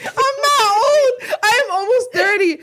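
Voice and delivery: high and loud, crying with laughter; adult daughter laughing at herself, high and loud; laughing protest. Real, not acted. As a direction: wail it while cracking up. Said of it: "laughing protest".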